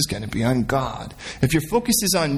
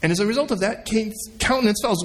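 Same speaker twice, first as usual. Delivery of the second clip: ordinary talk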